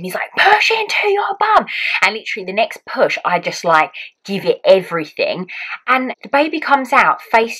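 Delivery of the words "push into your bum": high, drawn-out cheer-on yell; imitated coaching shout. Partner joining the midwives' chant, shouting. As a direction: join in shouting the push. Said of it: "Ken starts joining in".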